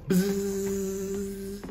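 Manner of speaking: soft fly buzz; buzzing insect voice. Pretend. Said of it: "[name]'s gonna pretend to be a fly".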